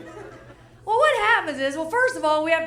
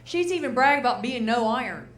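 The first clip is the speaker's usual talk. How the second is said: normal talking voice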